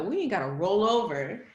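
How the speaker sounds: ordinary delivery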